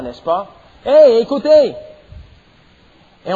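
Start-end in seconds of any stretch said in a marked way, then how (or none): 0.8-1.7 crié haut et fort pour se faire obéir; cri d'un parent. Shown as a demonstration. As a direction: lance un « hey » sonore aux enfants. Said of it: "Élever la voix". (appel crié, voix levée)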